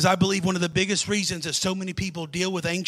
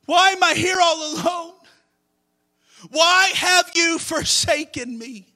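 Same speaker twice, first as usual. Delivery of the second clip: anguished plea; 7 semitones higher, 4 dB louder than usual. Acted. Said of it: "he was in such agony".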